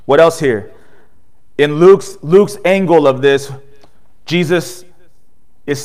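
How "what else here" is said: ordinary talk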